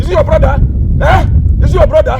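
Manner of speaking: loud, combative challenge; indignant shouting. Acted. Rebuked man demands whose brother has died, indignant shouting. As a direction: throw the question in their face, loudly. Heated quarrel over brother's death.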